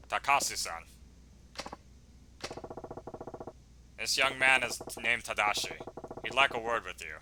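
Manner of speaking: low, soft, measured man; quiet deep-pitched gentleman tough guy; calm protector. Acted. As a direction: speak low and politely on the boy's behalf.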